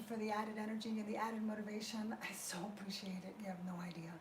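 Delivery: low, soft whispered thanks. Real, not acted. Grateful aside to viewers.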